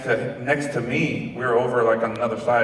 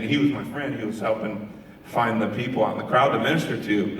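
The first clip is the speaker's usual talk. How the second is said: ordinary delivery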